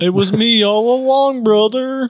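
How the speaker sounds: high theatrical villain voice; gloating villain reveal. Retold or imitated.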